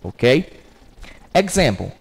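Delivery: normal talking voice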